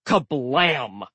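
usual speaking voice

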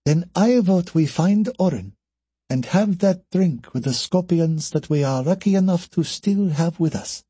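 high, lilting woman's voice; Anna's character voice. Acted. Voices Anna, consoling.

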